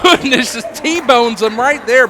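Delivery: loud high amazed call; excited call. Real, not acted. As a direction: erupt at the big slam. Big move called.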